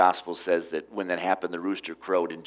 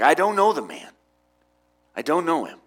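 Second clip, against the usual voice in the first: firm denial; 6 semitones higher, 5 dB louder than usual. Acted. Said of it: "gone beyond simply saying".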